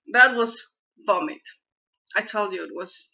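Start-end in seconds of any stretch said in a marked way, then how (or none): 0.1-1.4 disgusted. Real, not acted. Reacts to a gross bean.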